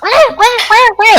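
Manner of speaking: very high panicked monkey wails; monkey screech. Acted. Game master voicing panicking monkeys, very high screeching wahs. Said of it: "start to screech".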